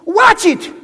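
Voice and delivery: loud, high warning yell; angry outburst. Retold or imitated. Irritated passenger warning whoever steps on the shoes, angry outburst. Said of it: "get stomach pain and get angry".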